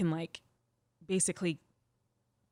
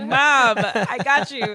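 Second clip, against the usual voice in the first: loud, higher, laughing gotcha to mom; laughing triumph; 5 semitones higher, 11 dB louder, much slower than usual. Retold or imitated. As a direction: crow it through laughter. Laughing triumph retold to mother.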